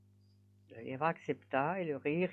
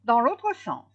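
high, loud exclamation; hare crying out; 10 semitones higher, 7 dB louder than usual. A narrator's reading. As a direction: exclaim it as a determined challenge. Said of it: "Et s'écria". (exclamation forte et aiguë du lièvre)

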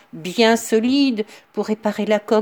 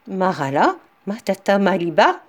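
plain reading voice

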